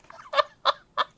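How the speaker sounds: squealing delighted laugh; high burst of laughter. Real, not acted. Reader cracking up at Jin, high squealing laugh.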